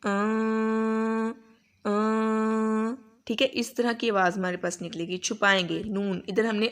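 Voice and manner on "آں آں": slow long nasal hum with the ن hidden; drawn-out hum, ن hidden in the nose; demonstrating ikhfa. Shown as a demonstration. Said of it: "اس طرح اسے چھپائیں گے".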